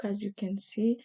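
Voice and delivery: ordinary talk, nothing marked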